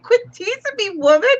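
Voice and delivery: high, indignant dog voice; voicing the dog. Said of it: "she's like".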